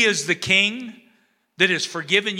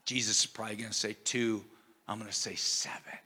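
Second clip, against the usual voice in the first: muttered scheming; 10 semitones lower, 14 dB quieter, faster than usual. Retold or imitated. Peter's inner scheming, muttered.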